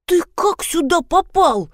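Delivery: high, slow scolding hiss; strained, high-pitched reproach. A narrator's reading. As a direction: hiss the question in indignant shock. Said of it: "зашипела я".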